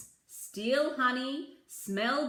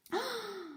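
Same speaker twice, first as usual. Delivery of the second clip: soft gasp; 10 semitones higher, 7 dB quieter than usual. Acted. Reader gasps.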